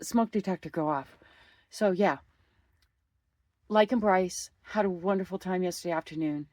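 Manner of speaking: normal talking voice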